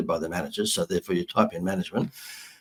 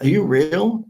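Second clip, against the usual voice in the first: high, louder, imitating disbelief; incredulous question; 9 semitones higher, 6 dB louder, slower than usual. Manager phones the prank caller back, mock incredulous. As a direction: quote him in astonishment, pitch climbing.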